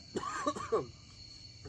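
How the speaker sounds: strained cough from spicy food; coughing fit; hacking cough. Real, not acted.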